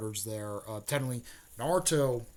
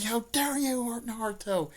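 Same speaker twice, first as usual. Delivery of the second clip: higher mock indignant cry; hammed-up outrage, pitched high; Hinata indignant; 11 semitones higher than usual. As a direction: scold him in a pitched-up girl's voice. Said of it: "she's like, she's like".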